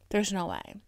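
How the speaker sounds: usual speaking voice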